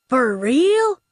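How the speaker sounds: high, loud, rising disbelief; amazed question. A narrator's reading. Sidekick amazed by the attack poodle, amazed question. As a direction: ask it high, like a gullible sidekick.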